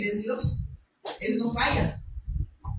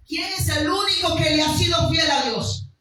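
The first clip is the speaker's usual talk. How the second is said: louder, higher preaching climax; fervent preaching; 5 semitones higher, 6 dB louder than usual. Acted. Pastor exhorts congregation, fervent preaching. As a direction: preach it with rising fire.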